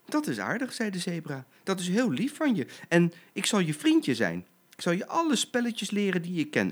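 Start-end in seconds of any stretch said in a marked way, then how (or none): 0.0-0.7 high small-animal voice; lightly voiced sweet zebra foal; grateful little zebra. Zebra figure in the story. 1.6-6.7 high small-animal voice; lightly voiced sweet zebra foal; grateful little zebra. Zebra figure in the story.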